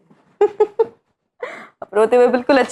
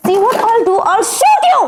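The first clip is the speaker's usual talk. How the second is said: high, loud, laughing threat; laughing mock threat; 9 semitones higher, 4 dB louder, much slower than usual. Boss teasing Sajan she will shoot him, laughing threat. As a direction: threaten him while cracking up.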